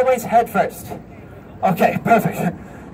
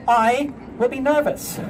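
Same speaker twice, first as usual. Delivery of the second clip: ordinary delivery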